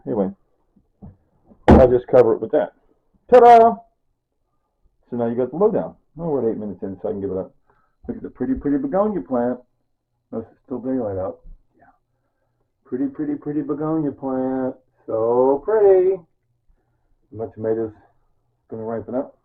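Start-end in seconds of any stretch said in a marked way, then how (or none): 3.3-3.8 much higher and louder, triumphant Ta-da; ta-da reveal. Real, not acted. Cook showing off finished containers, bright ta-da. 12.8-16.2 doting admiration. Real, not acted. Admiring the begonia plant.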